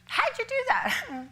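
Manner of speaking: very high, drawn-out astonished question; amazed disbelief. Retold or imitated. Speaker to Sue who got fries made, amazed. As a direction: squeak it out slowly, hammed-up amazement.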